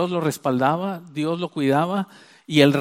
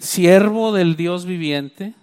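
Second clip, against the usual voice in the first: "siervo" lifted, then each word drawn out; slow solemn emphasis; 4 dB louder, much slower than usual. Shown as a demonstration. Preacher stresses the king's title for Daniel, slow and solemn. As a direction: dwell slowly on "siervo del Dios viviente".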